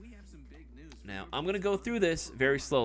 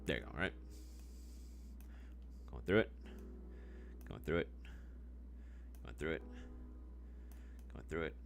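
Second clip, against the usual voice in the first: ASMR voice; 12 semitones lower, 12 dB quieter than usual. Acted. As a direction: draw it out softly like an ASMR video. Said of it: "Do you guys like my A S M R voice?".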